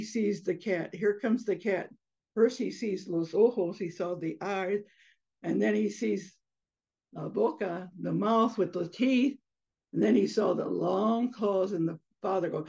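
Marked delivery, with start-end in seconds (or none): none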